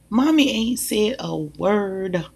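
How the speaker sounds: usual speaking voice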